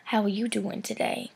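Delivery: mock come-on in a whisper; creepy whisper. Guy going up to someone he doesn't know, mock creepy whisper. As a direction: whisper it like an overly forward flirt. Imitating an overly forward guy's approach.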